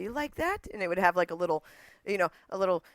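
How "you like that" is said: higher-pitched hint of British accent; slight British accent. Daughter imitating mom back from England, slight British accent. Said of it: "this accent on a specific phrase or word".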